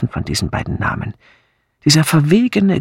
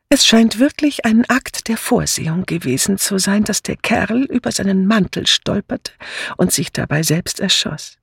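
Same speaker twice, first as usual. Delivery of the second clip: put-on higher, chatty elderly visitor; chatty old lady; 6 semitones higher than usual. A narrator's reading. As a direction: prattle on in a raised ladylike voice. (geschwätzige alte Dame, hohe Stimme)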